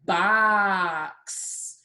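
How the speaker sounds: BOX stretched with a long vowel; said slowly. Shown as a demonstration. Teacher asking for the ending sound, BOX said slowly. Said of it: "We like to say it slowly".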